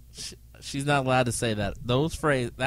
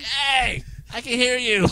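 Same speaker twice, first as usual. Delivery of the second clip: put-on high, loud child's protest; child calling out; 11 semitones higher, 6 dB louder, slower than usual. A boy calling to the teasing guests, high and loud. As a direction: call out like a kid who overheard. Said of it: "You're like".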